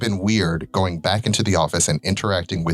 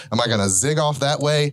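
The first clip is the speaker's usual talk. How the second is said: ordinary delivery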